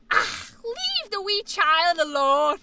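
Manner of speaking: loud, slow Irish growl of reproach; groan, then scold in a thick Irish accent; Irish grandpa. Acted. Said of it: "he would get this thing on his face".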